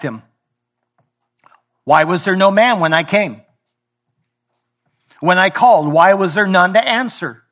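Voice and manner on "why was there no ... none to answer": louder, higher pleading rebuke; preached rebuke. Retold or imitated. God rebuking his people, raised pleading cry. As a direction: cry out the question. God's rebuke quoted in sermon.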